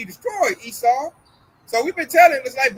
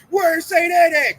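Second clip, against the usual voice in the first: mock Esau; 7 semitones higher, 5 dB louder, slower than usual. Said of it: "Esau be like".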